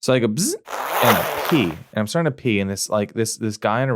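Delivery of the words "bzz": zipper noise. Retold or imitated. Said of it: "That's my zipper".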